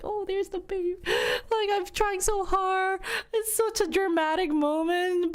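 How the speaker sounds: high, teary melodrama voice; hammed-up movie mom. Mom parodying a movie birth scene, high and melodramatic. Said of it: "the movie was like".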